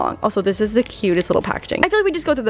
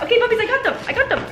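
high sing-song pet talk; dog-talk voice; 11 semitones higher, much faster than usual. Real, not acted. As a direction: coo at the dog, pitched up. Talking to dog.

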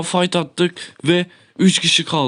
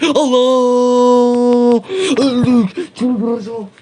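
loud, high cry of dismay; frustrated shout; 6 semitones higher, 4 dB louder, much slower than usual. Real, not acted. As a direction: yell it out as you get killed. Dying in the game match.

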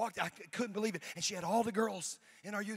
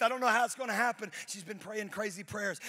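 ordinary talk, nothing marked

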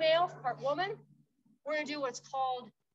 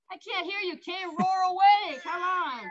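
coach shout; 5 semitones higher, 8 dB louder than usual. Real, not acted. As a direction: cheer her on loudly. Coach urging partner to roar.

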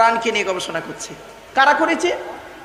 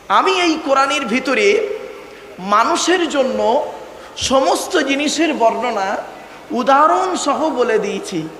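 slow solemn quote; slower than usual. Acted. Quoting Allah's words solemnly.

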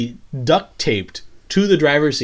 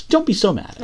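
higher-pitched mock plea; lightly acted appeasing, pitched up; high placating voice; 8 semitones higher, much faster than usual. Recapper voicing a character's plea again, high placating voice.

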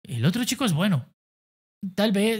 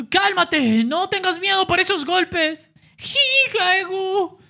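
shouting coach; 13 semitones higher, slower than usual. Acted. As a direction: yell at your fighter to calm down. Coach yelling advice to fighter.

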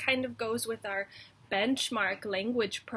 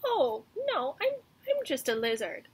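timid lizard; 5 semitones higher than usual. Acted. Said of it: "said the lizard".

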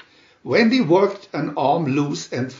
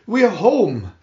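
happy call, higher and louder; excited shout; 5 semitones higher, 3 dB louder than usual. Acted. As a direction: call out joyfully. Children shouting arrival.

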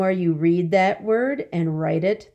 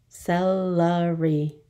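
"Celery" slow and split, ce-le-ry; syllable by syllable; slower than usual. Shown as a demonstration. Teacher repeating for the class, "Celery" in slow parts. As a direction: slowly sound out each beat of "Celery". Syllable lesson, word broken up.

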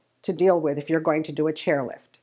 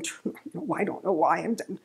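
muttering under breath; 7 dB quieter than usual. Retold or imitated. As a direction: mutter it to yourself while pulling ivy. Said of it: "muttering under my breath".

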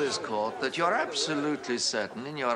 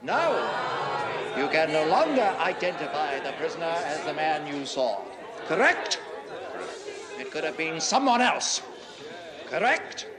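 forceful cross-examination; 4 semitones higher than usual. Acted. Counsel repeating the witness's admission to the court, forceful and emphatic. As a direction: ring out the key words to drive the admission home.

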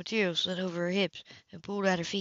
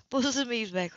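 usual speaking voice

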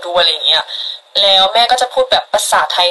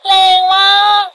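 much higher, thrilled exclamation; excited squeal; 10 semitones higher than usual. Real, not acted. Streamer thrilled at a viewer's huge gift.

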